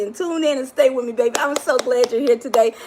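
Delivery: usual speaking voice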